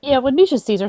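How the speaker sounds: ordinary talk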